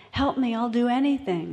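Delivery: storyteller playing a pleading man; acted desperate plea. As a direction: beg God to save you, hammed up for the story. Man on cliff begging for help.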